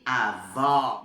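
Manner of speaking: AVÓ with a loud, wide-open ó; open vowel. Shown as a demonstration. Teacher showing the accent that opens the sound, open vowel. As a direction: open up fully on the end of AVÓ. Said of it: "Aqui pra abrir".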